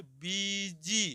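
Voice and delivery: "बीजी" very slow, both ई vowels held; long ee sound. Shown as a demonstration. Teacher sounding out "बीजी" for pupils, long ई drawn out. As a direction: stretch "बीजी" softly, syllable by syllable. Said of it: "ई का sound लेके".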